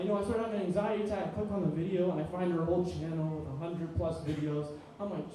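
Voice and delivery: ordinary talk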